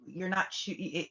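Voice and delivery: ordinary talk, nothing marked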